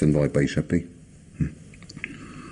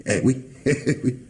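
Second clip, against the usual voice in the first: gloating laugh; 6 semitones higher than usual. Acted. Commissaire laughing at the suspect's confusion, gloating. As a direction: chuckle through a triumphant yes.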